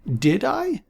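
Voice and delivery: put-on high, puzzled old voice; a forgetful old man, lost; confused old man. A narrator's reading. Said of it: "asked Aaron in a confused tone".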